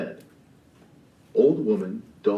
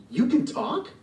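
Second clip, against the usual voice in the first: high, startled double take; startled that the cat talks; surprised. Acted. Gigi shocked the cat can talk, surprised.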